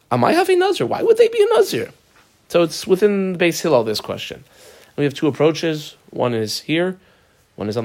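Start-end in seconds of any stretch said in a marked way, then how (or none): 0.1-1.9 high-pitched, baffled challenge; puzzled protest, voice leaping high; incredulous questioning. Acted. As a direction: ask it as a baffled objection, pitched way up. Acting out the Gemara's puzzled objection.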